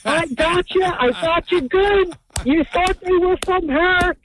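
high, drawn-out mock-gloating boss. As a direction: imitate a boss crowing over his prank, stretching the words high. Boss gloats over the prank, retold.

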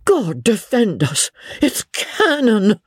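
high, alarmed cry, slowing on cannon; startled by the guns. A narrator's reading. Missus O'Dowd cries out at the window in alarm. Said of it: "Missus O'Dowd cried".

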